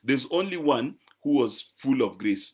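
ordinary delivery

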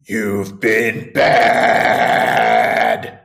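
a mock-menacing mother, dropping very low; mom's creepy line. Doing the mother's line in a deep, drawn-out creepy voice. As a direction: drop deep and slow on bad. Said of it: "Her line is".

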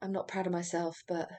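lightly mocking a pretentious woman; a quiet, put-on earnest confession; soft mock-solemn imitation; pretentious quote. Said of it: "she's so pretentious and, like, contrived".

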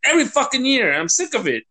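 fed-up outburst, high then dropping; sick-of-it venting; exasperated rant. Real, not acted. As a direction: start high and worked-up, then sink. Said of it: "Sorry for my rant".

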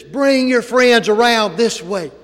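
high, loud and slow booming call; Dale Jarrett booming. Retold or imitated. Dale calls Jack's friends over from the speaker, booming. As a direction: boom it out over the crowd. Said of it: "and he says".

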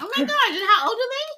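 high delighted surprise; surprised. Real, not acted. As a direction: gasp it out in surprise.

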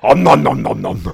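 loud, greedy munching monster noises; Cookie Monster. Acted. Co-host morphing an impression into munching monster, loud gobbling. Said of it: "more of a Cookie Monster thing".

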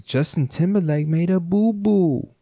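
mock babyish "boo-boo"; babyish understatement. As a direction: play it down like a tiny oopsie. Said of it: "He made a little whoopsie".

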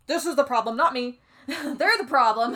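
playfully indignant imitation, breaking into a laugh; hammed-up self-excusing finger-pointing; mock blame-shifting. Mocks the villain shifting the blame.

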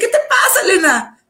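pregunta muy aguda del amigo, entre risas; amigo alarmado. Retold or imitated. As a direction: imita al amigo con voz mucho más alta y alarmada. El amigo la ve golpear el timbre. (el amigo le pregunta qué le pasa, muy agudo y alarmado)

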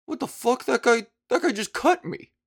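high incredulous what-the-hell; baffled disbelief. Retold or imitated. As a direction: play the stunned guy who just got cut. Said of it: "the dude was like".

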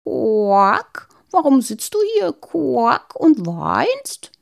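high, croaky toad voice with quacks; croaking toad. Acted. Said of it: "und quakte".

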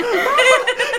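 high-pitched cackling laugh; real laugh. Real, not acted. Host cracking up at co-host's budget airline joke, high laughter.

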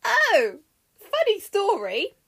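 high, loud, gleeful opener; grinning. Acted. Mercury starts his tale to Argus, grinning. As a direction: launch a tale with a grin in the voice. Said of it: "Mercury grinned".

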